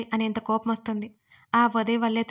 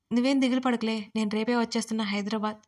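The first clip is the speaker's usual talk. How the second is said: usual speaking voice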